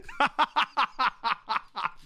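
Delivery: high staccato cackle; helpless rattling laughter; laughing fit. Real, not acted. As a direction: burst into a long rapid laugh. Cracks up after his own jibe.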